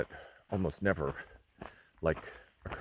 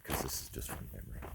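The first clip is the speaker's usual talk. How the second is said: ordinary talk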